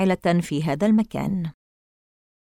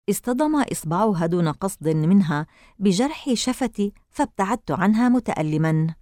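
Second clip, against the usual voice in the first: plain reading voice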